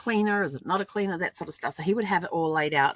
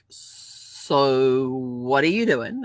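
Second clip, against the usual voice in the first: lower, very slow questioning husband; slow mock Brad; 5 semitones lower, much slower than usual. Puzzled husband slowly questions his wife. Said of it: "he's like".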